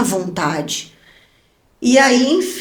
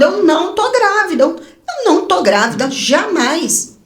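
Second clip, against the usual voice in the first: negação desesperada; 6 semitones higher than usual. Retold or imitated. Negação desesperada durante o exame.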